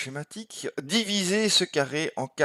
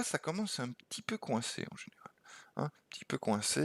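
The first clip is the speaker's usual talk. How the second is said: whisper; 9 dB quieter than usual. Real, not acted. Teasing aside on being stuck.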